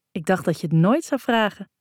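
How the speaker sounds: usual speaking voice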